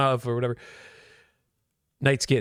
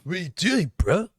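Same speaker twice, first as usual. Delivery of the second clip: high surfer dude voice; laid-back surfer bro, pitched up; Mikko Rantanen; 8 semitones higher than usual. Pretend. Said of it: "talks like a surfer dude".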